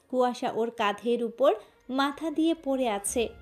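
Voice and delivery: ordinary delivery, nothing marked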